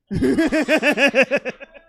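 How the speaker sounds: high, loud staccato laugh; real giggling fit. Real, not acted. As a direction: burst out giggling mid-sentence. Comedian cracks up mid-sentence.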